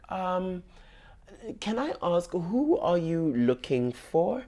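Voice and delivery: high, slow, sweetly probing voice; sly investigator. Retold or imitated. Coaxing the girl on the phone for her name, sly high sweetness. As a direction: fish for answers like a cunning journalist. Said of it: "put on your Deborah Patter boots".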